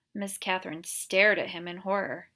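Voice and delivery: usual speaking voice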